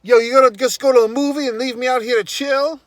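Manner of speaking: high, loud, fast mock yell; imitated yelling. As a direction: mimic a sister telling off her brother over the phone. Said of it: "she's just yelling at him".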